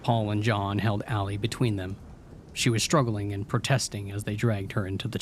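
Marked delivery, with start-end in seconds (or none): none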